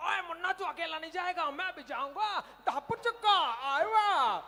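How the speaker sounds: high, loud, excited cartoon shout; cartoon character voice. Comic imitating a cartoon friend, high and excited. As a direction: bounce it up like a wild cartoon sidekick. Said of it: "मैंने सुना है जंत भाई".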